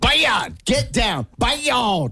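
scolding the dog. Pretend. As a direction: call the dog off the counter, high and stern. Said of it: "Pretend like Odin just jumped onto the counter".

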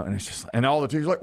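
normal talking voice

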